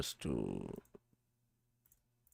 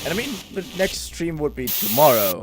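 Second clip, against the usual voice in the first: playful; 6 semitones higher, 8 dB louder than usual. Real, not acted. Playful outro remark.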